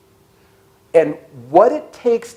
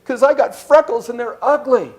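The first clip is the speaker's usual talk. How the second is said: put-on higher, childlike voice of a hurt boy; heartbroken little boy; 6 semitones higher than usual. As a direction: tell grandma, crushed like a small child. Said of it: "goes back to his grandma and goes".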